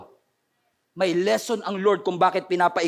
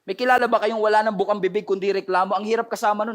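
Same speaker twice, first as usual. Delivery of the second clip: ordinary delivery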